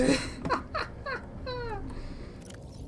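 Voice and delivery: breathless high-pitched giggle. Real, not acted. Player greeted by the ship computer, high giggle. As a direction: giggle up high, unable to stop. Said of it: "That made me laugh".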